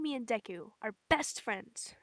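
ordinary delivery

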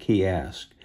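plain reading voice

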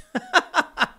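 high burst of laughter; laughing. Real, not acted. Host cracking up at the actress's complaint, laughing. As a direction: crack up at your own mockery. Laughing after mocking the quote.

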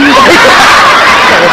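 loud shouting laugh; big laughing burst. Real, not acted. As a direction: roar with laughter. Laugh after punchline.

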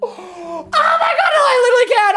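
high laughing scream of disbelief; laughing-crying shriek at the clip; shrieking laughter. Real, not acted. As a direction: squeal through uncontrollable laughter. Streamer bursting at a funny clip.

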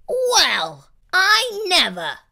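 loud, huffy indignation; offended sniff. A narrator's reading. Monster insulted at being sent away, offended huff. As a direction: huff it like an affronted lady. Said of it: "She sniffed".

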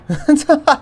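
high, loud burst of laughter. Real, not acted. Gamer laughing at her own move, high burst of laughter. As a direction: crack up suddenly.

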